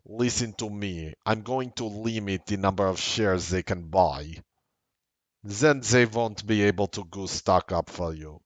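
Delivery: mock Russian-accented executive on the phone; comic Russian accent. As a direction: put on a Russian accent for laughs. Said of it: "do it in a Russian accent".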